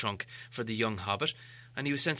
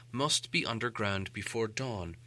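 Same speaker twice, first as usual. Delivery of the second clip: ordinary delivery, nothing marked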